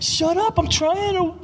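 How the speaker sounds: put-on very high, whiny child voice; mock a complaining kid, stretching the last words; squealed protest in a put-on child's voice; imitated kid's yell. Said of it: "they'll just yell at me".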